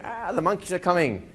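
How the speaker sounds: high panicky cry of a client in trance; mock panic. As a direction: act out a client freaking out. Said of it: "suddenly they start freaking out".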